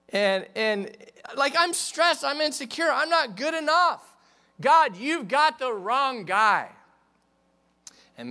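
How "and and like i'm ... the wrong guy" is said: high, loud, panicky; high frantic complaint; stressed protest. Retold or imitated. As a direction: plead loudly that you're not good enough. Voicing Gideon's stress.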